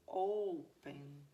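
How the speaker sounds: O-pen, a long strong O and a light ending; stress on the O. Shown as a demonstration. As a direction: stretch and hit the first syllable of "Open". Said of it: "A sílaba tônica vai ficar aqui no O". ("Open" com o O tônico forte e alongado)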